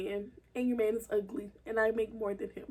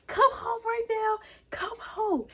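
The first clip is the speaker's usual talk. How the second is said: worried mom; 9 semitones higher than usual. Retold or imitated. Mom voiced in retold story.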